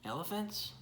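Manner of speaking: ordinary delivery, nothing marked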